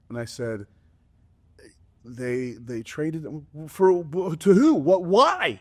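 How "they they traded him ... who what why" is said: rising into shocked disbelief; son's disbelief. Retold or imitated. Son voiced hearing Luka trade.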